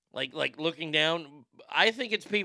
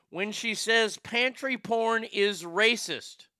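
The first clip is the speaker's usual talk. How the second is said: slow, rising in disbelief; incredulous; 5 semitones higher, 6 dB louder, slower than usual. Real, not acted. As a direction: build to a loud disbelieving punchline. Incredulous build to punchline.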